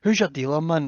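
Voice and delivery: ordinary talk, nothing marked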